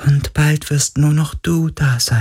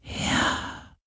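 faint breathed yes; 12 dB quieter than usual. Acted. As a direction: barely breathe it out. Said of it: "hauchte der Geist des Meeres".

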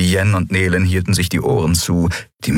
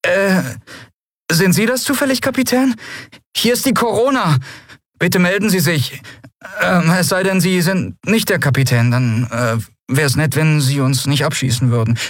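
put-on high, hesitant young voice; jittery high-pitched radio hail; nervous; 11 semitones higher than usual. A narrator's reading. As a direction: stammer politely and nervously. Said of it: "eine nervöse Stimme fragte".

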